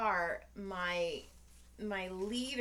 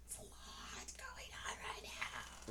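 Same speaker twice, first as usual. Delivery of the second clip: slow, high, strained whisper; overwhelmed whisper; 13 dB quieter, slower than usual. Acted. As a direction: squeeze it out in a tiny whisper. Acts out overwhelmed reply to him.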